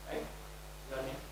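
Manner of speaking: ordinary talk, nothing marked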